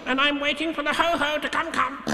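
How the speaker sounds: high, small nervous voice; unsettled little piglet explaining; flustered Piglet. Acted. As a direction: keep bluffing in a tiny high voice. Flustered Piglet keeps up bluff.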